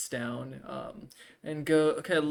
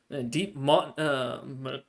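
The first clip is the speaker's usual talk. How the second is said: ordinary talk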